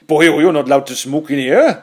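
loud, high Welsh cop telling off; Welsh accent. Retold or imitated. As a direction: scold the smoker in a Welsh accent. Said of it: "with that Welsh guy going".

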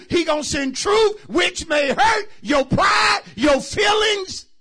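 loud high shouted call to the congregation; preaching at full force. Acted. Preacher rousing the church, full-force shouting. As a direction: whoop it out, stretching words.

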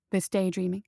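usual speaking voice

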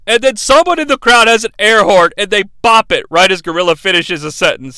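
ordinary talk, nothing marked

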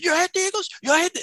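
the cab driver blowing up at his passenger; outraged cab driver. Retold or imitated. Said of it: "the cab driver loses it".

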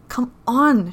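loud, high, petulant whine; impatient whine. A narrator's reading. She demands he get moving, impatient whine. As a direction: whine it like someone spoiled and impatient. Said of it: "pitches it into an impatient little whine".

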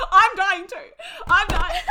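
loud, high, through real laughter; helpless, excited laughter; laughing hard. Real, not acted. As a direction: cry it out while cracking up. Overcome with laughter at the scene.

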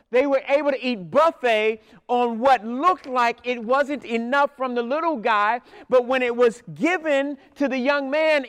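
fervent pastor lifting the congregation; preaching at full force. Real, not acted. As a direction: build the climax and drive it home. Sermon climax.

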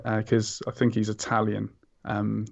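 usual speaking voice

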